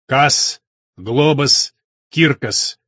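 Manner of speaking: loud, solemn, menacing chant of spell words; menacing solemn incantation. A narrator's reading. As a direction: intone it like a sorcerer's summons. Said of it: "грозным чужим голосом торжественно".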